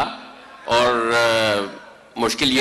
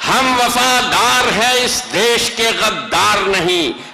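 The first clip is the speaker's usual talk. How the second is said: higher, rising emphatic repeat; stately nazm recitation; 8 semitones higher, much slower than usual. Acted. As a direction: lift the repeat higher for effect. Repeat of couplet. (بلند آہنگ میں شعر کی تکرار)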